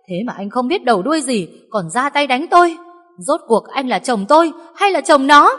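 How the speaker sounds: high, loud wife's outcry; tearful shouting. A narrator's reading. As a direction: shout at the husband through tears. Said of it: "cô hét lên". (vợ vừa khóc vừa hét)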